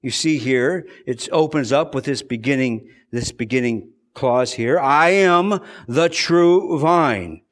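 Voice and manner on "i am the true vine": I am the true vine, each word drawn out slowly; slow emphatic quote. Shown as a demonstration. Preacher stressing the words of Jesus, slow and deliberate. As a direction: lay it out word by word from the pulpit. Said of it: "Jesus doesn't say that".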